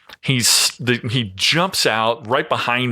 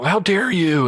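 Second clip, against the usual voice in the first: outraged scolding; 10 semitones higher than usual. Retold or imitated. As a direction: play the furious owner scolding him over a dishcloth. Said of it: "she's really starting to jump on me".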